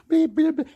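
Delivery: high, loud squeaky bleeps; imitated bleeps. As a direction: bleep like an alarm in a high pitch. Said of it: "I say things like".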